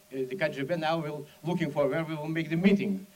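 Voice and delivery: usual speaking voice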